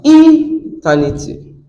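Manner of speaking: "eternity" with the first syllable drawn out high; stress on first syllable. Shown as a demonstration. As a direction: hit the opening syllable of "eternity" high and long, then drop. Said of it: "So what's the stress?".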